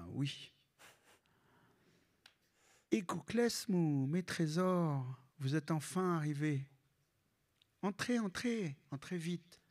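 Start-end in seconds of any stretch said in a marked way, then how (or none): 2.9-9.6 put-on high, doting old grandmother voice; imitating grandma. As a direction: coo at the grandkids like a fond grandma. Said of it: "trying to imitate my grandma".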